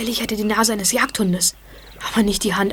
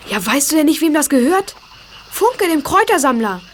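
higher, louder, thrilled; excited; 5 semitones higher, 5 dB louder than usual. Acted. Boy tells his friend he solved the riddle, excited. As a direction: burst out with the discovery. Friend explains the key's owner.

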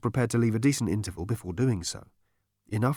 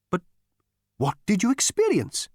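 astonished Gargravar; 10 semitones higher than usual. A narrator's reading. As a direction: ask in disbelief. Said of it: "Gargravar asks".

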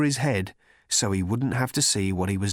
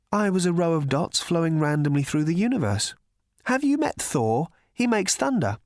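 Trillian voice; 6 semitones higher than usual. A narrator's reading.

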